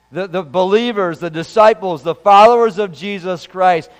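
ordinary delivery

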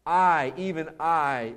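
slow, weighty stress on both "I"s in "I, even I"; emphatic I. Shown as a demonstration. Pastor demonstrating the Hebrew emphatic, slow stress. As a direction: drag out "I, even I" with the weight on each "I". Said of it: "is really the emphasis".

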